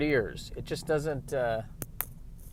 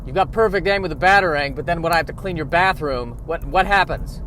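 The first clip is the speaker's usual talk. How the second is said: higher and louder scolding; aggravated Alfred; 5 semitones higher, 5 dB louder than usual. Pretend. Butler scolds Batman over the bathroom, higher and louder. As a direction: nag the boss about the mess. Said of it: "Alfred's aggravated".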